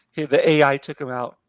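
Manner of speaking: ordinary talk, nothing marked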